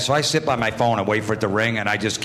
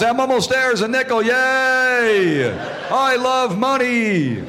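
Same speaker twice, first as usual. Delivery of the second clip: high-pitched gleeful simpleton, stretching the cheers; hammed-up childlike prank-call glee; idiot character voice; 11 semitones higher, much slower than usual. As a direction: crow like an overjoyed dimwit. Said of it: "how I came up with that character".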